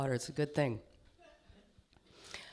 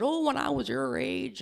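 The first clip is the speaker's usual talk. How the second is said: higher-pitched mock Southern voice; southern accent; 7 semitones higher than usual. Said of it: "I gave them a southern accent".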